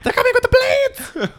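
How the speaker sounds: very high, loud hammed-up shriek; comic screaming plant in terror; mock panicked yell. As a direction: screech a warning to the other plants. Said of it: "It's plants yelling".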